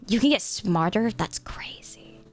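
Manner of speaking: hushed, whispered mock amazement. Mock amazement murmured to chat.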